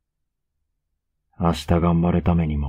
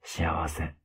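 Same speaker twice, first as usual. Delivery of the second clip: contented whisper; 9 dB quieter than usual. Acted. 彼女を撫でながら満足げに.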